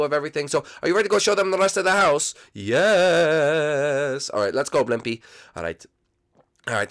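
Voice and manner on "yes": ghost voice. Acted. Blimpy answers.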